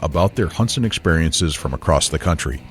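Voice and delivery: polished, punchy show-opening narration; projected announcer voice with steady energy; announcer intro read. Acted. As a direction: deliver it as a scripted podcast intro. Reads show's mission intro.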